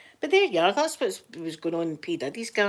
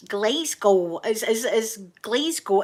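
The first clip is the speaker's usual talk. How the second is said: American Glasgow; 2 semitones higher, 5 dB louder, slower than usual. Retold or imitated. Mocking the Americans at the [name] trial, slow American "Glasgow". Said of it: "they say it pure weird".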